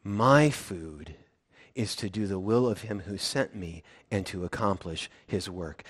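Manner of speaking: low, soft, measured teaching voice; gently explain, low and quiet; patient. Acted. Jesus connecting the dots for his disciples, patient. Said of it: "he probably did that pretty patiently".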